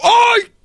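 very high yelp; hammed-up yelp of pain. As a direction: yelp from the cut. Customer cut.

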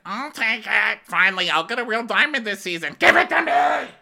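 high, grasping creature voice climbing to a shout; high-pitched hammed-up creature greed; Gollum. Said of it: "Gollum [name]".

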